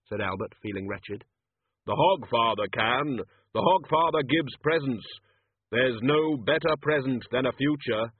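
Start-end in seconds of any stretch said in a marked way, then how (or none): 1.9-8.1 slow, grave, resolute; Death's voice. Acted. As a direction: speak as Death, firmly. Death decides to save girl.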